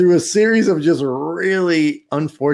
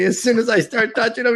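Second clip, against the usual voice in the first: ordinary talk, nothing marked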